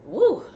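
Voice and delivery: high, loud "woo"; big high exhale of relief from coughing; coughing-fit whoop. Real, not acted. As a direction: whoop it out after a hard cough. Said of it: "all this coughing".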